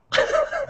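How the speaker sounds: a squealing laugh breaking out; very high squeal of laughter. Real, not acted. Laughing at his own retold insult.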